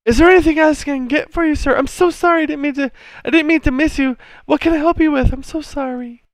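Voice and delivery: high, flustered falsetto; frightened barmaid. Acted. Barmaid begging to help an angry customer, flustered. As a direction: fawn anxiously in a very high voice. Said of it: "And the barmaid's".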